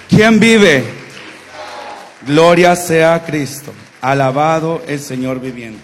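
normal talking voice

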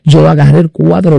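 ordinary talk

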